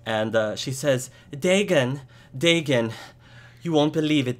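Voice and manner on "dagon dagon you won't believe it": raised, louder urgent calling; agitated wife. Acted. Wife arriving with news for her husband, agitated. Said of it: "his wife, uh, arrives".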